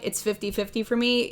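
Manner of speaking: usual speaking voice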